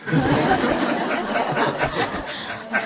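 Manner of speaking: laughs at own joke, under the breath; breathy laugh. Real, not acted.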